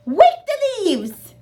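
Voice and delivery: high, slow, loud command; calling out. Acted. Wadney calling the game, high and loud. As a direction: call it out to the players.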